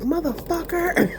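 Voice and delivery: high, slow drawn-out curse at the computer; mock cursing at game. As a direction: sneer it at the NPC.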